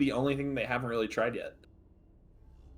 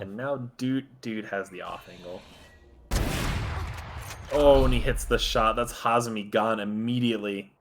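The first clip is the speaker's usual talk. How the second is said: esports caster. Acted. Live play-by-play casting.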